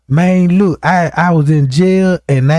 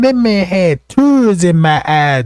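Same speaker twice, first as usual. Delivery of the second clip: ordinary delivery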